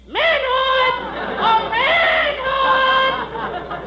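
drawn-out high calling shout. Acted. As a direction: holler it into the distance. Calling out for his manhood.

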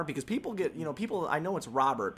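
ordinary delivery

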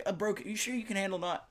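high, lightly put-on bro; a hint of a teasing brother; imitating the brother; mock bro voice. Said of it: "He's like".

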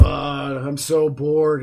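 very slow, drawn-out bored groan; exaggerated slow complaint; mock boredom. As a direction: imitate a bored co-host, dragging every word. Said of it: "all of our audience is bored".

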